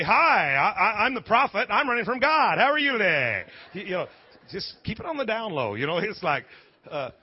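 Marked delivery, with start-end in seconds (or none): 0.0-3.4 loud, high, hammed-up greeting; mock cheery introduction. Preacher plays a runaway prophet greeting people, mock cheery introduction. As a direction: announce yourself far too boldly to strangers. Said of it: "you don't walk up and say".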